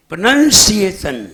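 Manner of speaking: प्रोनाउंसिएशन said loudly as "pra-NAN-si-e-shan"; loud, emphatic प्रोनाउंसिएशन with "nan", not "noun"; correct pronunciation. Shown as a demonstration. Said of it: "बोलना सीखिए".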